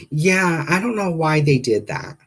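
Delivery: high-pitched receptionist impression. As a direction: imitate a clueless call handler. Receptionist voiced in retold call.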